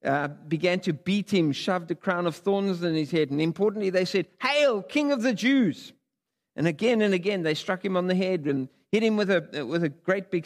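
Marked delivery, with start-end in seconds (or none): 4.4-5.9 high, slow sneering hail; mock homage. The soldiers mock Jesus as king, sneering high hail. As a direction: soldiers jeering at Jesus with fake worship, high and drawn out. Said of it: "they mocked him".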